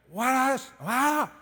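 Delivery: high-pitched garbled broken English; broken English accent. Retold or imitated. A guide asking another question, broken English accent. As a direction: imitate a guide's barely understandable English accent. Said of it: "real broken English accent".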